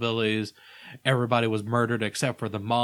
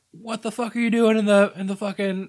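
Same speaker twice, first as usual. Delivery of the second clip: higher, louder shout; yelling; 10 semitones higher, 6 dB louder than usual. Retold or imitated. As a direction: yell it at intruders. Tucker and Dale yelling.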